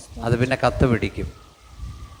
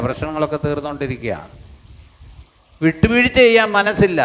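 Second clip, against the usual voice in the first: preaching at full force; 5 semitones higher than usual. Acted. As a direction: preach it hard, deliberate and emphatic. Preacher rebukes the congregation.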